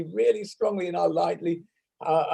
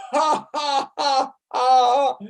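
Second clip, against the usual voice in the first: ha, ha pitched higher; higher ha laughs; 7 semitones higher, 3 dB louder, much slower than usual. Shown as a demonstration. Laughter teacher on Zoom, higher ha, ha. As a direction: let the ha climb up. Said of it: "And again".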